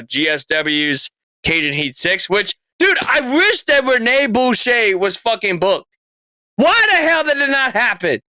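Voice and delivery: ordinary delivery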